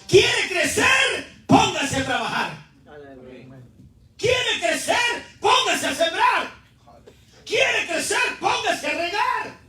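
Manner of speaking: high, drawn-out rhythmic challenge; fervent preaching. Real, not acted. Preacher challenging the church to work for growth, fervent preaching. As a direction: call out question and command like a refrain.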